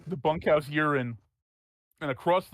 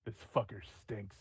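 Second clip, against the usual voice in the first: low feral growl; 5 semitones lower, 6 dB quieter, slower than usual. Acted. GM voices Ruckus's feral thought.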